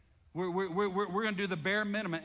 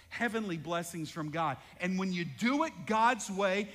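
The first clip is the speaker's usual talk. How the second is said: ordinary delivery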